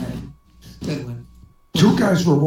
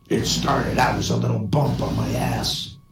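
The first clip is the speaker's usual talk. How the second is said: low, softer voice for a talking frog; lightly voiced frog, pitched down; frog character; 6 semitones lower, 4 dB quieter than usual. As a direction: drop down low for the frog's deadpan punchline. Frog answers in a joke.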